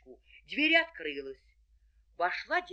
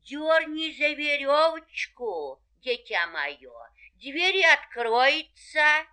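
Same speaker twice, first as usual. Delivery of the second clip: grandma calling; 7 semitones higher, 6 dB louder, slower than usual. A narrator's reading. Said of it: "и крикнула".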